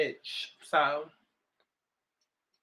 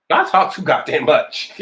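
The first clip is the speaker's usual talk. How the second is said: louder; 9 dB louder than usual. Real, not acted. Scolding viewers jokingly.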